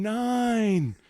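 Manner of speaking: high far-off counting call; mock gym announcer counting off; distant announcer count. Imitating announcer counting outside.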